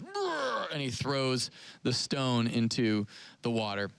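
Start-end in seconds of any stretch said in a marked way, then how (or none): 0.0-0.7 high rolled grunt as he hurls it; playful imitated heave sound; mock effort noise. Peter throwing his stone in the water, high rolled grunt. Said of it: "and Peter goes".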